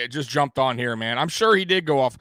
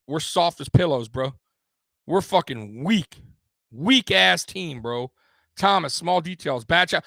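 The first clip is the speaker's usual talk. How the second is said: ordinary talk, nothing marked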